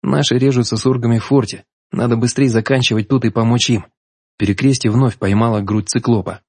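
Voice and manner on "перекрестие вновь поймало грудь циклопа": usual speaking voice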